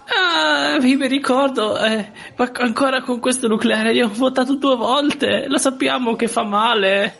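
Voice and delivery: put-on high whiny old lady. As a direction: complain in a high, old woman's voice. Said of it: "signor Lisi". (voce da vecchietta lamentosa)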